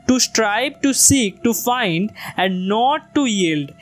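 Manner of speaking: to strive, to seek slowly, with weak to and strong strive, seek, find, not, yield; iambic stress. Shown as a demonstration. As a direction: recite it slowly, stressing each second beat. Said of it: "টুটাকে আমরা slowly বলবো". (Iambic ছন্দে ধীরে আবৃত্তি, দ্বিতীয় শব্দে জোর)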